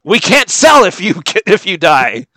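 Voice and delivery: high, loud squeaky exec; mock executive voice. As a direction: screech like a panicked executive. Mocking a Disney executive.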